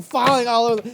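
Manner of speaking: ordinary delivery, nothing marked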